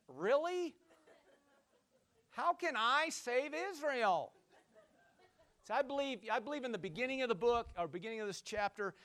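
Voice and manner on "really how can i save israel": higher, drawn-out questioning; imitated doubting reply. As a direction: act out a doubter questioning his calling. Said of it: "he's talking like this".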